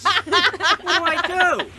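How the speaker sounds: high, slow, cracking with laughter; hammed-up anguish; laughing despair. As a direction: wail it through a laugh.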